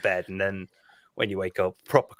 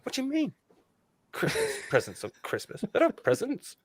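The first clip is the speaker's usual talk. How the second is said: imitated bafflement, higher and softer; taken aback; 6 semitones higher, 6 dB quieter than usual. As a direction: puzzle over it in mock disbelief. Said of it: "taken aback by the idea".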